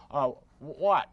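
ordinary talk

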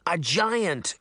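restrained storybook call. A narrator's reading. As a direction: call it out, slightly urgent. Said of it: "the two girls called out".

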